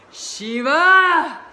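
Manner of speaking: high, loud scream of rage; furious shout. Acted. Parvati screaming at Shiva over her dead son, enraged. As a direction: scream his name in fury. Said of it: "as she's shouting".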